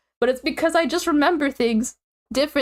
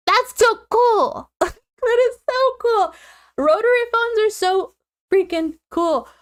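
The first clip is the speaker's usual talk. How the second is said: excited gush; 9 semitones higher than usual. Real, not acted. Gushing to listeners about rotary phones, high and excited. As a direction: squeal over the rotary phone.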